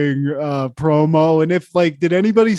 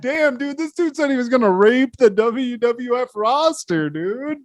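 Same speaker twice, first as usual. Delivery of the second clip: higher, amazed disbelief; stunned; 8 semitones higher than usual. Retold or imitated. Fan reacting to Pillman's promo, higher amazed disbelief. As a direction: gawk at what he just heard.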